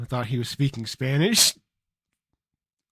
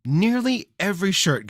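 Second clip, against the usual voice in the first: higher, sarcastic echo; mocking repeat; 7 semitones higher, 3 dB louder than usual.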